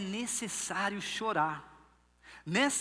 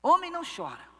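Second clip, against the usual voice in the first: stern parent; 7 semitones higher, 5 dB louder than usual. Retold or imitated. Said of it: "o que que os pais falam pras crianças".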